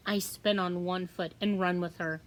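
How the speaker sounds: usual speaking voice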